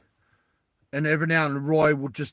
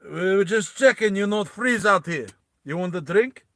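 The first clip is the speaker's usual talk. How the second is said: put-on higher, louder Russian-accented voice; Russian guy; 5 semitones higher, 5 dB louder than usual. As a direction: put on a thick-headed Russian guy's accent. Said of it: "the Russian guy's like".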